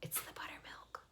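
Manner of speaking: soft breathy whisper. A narrator's reading. Missus Arable whispers to Missus Zuckerman, hushed whisper. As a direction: whisper it aside to a friend. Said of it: "whispered Missus Arable".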